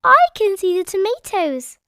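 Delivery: high loud cry; excited cry. A narrator's reading. Peppa spotting the tomatoes, excited cry. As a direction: call out spotting them. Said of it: "cried Peppa".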